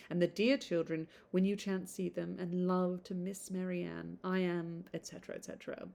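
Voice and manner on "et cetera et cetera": soft unvoiced tail; breathy dismissive whisper; whispered trail-off. Shown as a demonstration. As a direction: whisper the et cetera away. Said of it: "I love finishing a letter like that".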